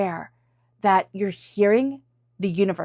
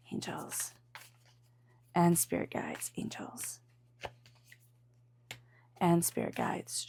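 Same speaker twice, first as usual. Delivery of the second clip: whispered prayer; 7 dB quieter than usual. Acted. Tarot reader calling spirit guides to guide reading, hushed prayer. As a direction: call on the angels, drifting into a hush. Repeated invocation, fading to whispers.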